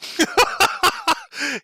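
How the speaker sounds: long high-pitched laugh; real shrieking laugh; high cackle. Real, not acted. Reactor laughs at fight.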